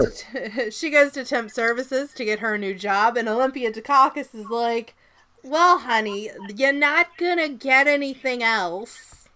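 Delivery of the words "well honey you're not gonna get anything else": drawn-out, hammed-up Jersey accent; bad Jersey accent. Imitating a film character consoling a job seeker, bad Jersey accent. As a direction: do a terrible slow Jersey accent. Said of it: "It's the worst Jersey accent".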